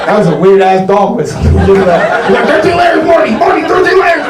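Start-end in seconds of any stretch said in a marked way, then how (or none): 1.7-4.2 high, loud, yelling cartoon voice. Comic mocking the crowd's makeup, shouting as [name]. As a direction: bellow it like a frantic cartoon scientist.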